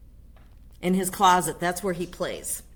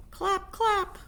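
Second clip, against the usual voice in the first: "Clap, clap" much higher, sung; squeaky clap. Acted. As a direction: chirp "Clap, clap" up high. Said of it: "He began to sing".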